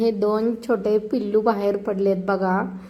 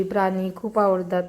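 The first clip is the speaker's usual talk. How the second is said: normal talking voice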